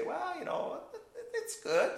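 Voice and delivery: mock hedging. Preacher mocks secular talk of good, high and laughing.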